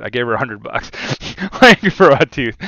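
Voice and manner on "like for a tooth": words through a laugh. Real, not acted. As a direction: laugh it out in disbelief. Laughing at the hundred bucks.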